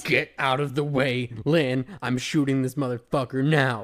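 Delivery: loud action-hero order; tough shout. Retold or imitated. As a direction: bark it like a gunslinger. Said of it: "Spike's like".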